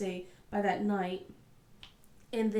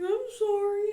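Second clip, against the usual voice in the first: high-pitched imitation of a kid's apology; put-on small-kid sorry, pitched way up; sheepish child; 11 semitones higher, 4 dB louder than usual. Daughter apologising to mom after scolding, sheepish.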